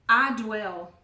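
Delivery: I dwell, said loud and firm; emphatic declaration. Acted. Preacher declaring her part to the congregation, loud. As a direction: declare I dwell with full force.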